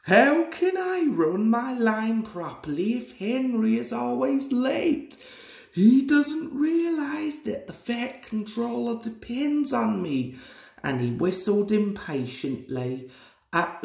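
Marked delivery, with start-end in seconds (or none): 0.0-10.4 higher, huffy complaining engine; cross grumble. A narrator's reading. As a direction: grumble impatiently at being kept waiting. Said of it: "Thomas was getting crosser and crosser".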